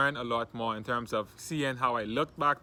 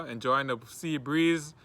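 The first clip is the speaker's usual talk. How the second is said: normal talking voice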